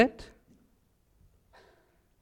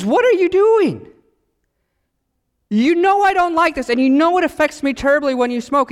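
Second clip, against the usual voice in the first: mock outraged; 9 semitones higher than usual. Girlfriend confronts smoking boyfriend.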